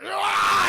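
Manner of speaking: high, loud battle yell; acted roar as you snap; enraged scream. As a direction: scream and charge. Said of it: "something just snapped in me".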